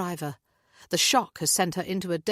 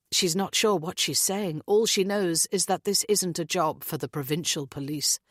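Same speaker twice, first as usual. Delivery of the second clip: ordinary delivery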